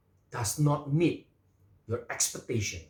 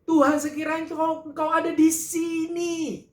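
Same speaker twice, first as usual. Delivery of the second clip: anguished plea; 13 semitones higher, 5 dB louder than usual. Acted. Preacher voicing Marta's grief.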